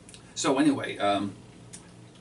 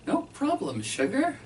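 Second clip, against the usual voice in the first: mock sweet; 13 semitones higher than usual. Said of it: "I say".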